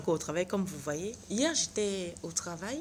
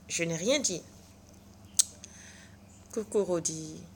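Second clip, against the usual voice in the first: ordinary talk, nothing marked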